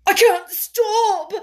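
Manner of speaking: high, loud hysterical wail with gasps; gasping sobs. A narrator's reading. Hysterical boy tells Mary he can't stop, sobbing. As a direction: sob it out loudly, gasping for breath. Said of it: "he gasped and sobbed".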